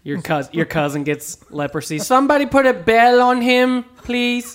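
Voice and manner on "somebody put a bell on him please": high, loud pleading voice; mock plea. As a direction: beg in a silly high voice.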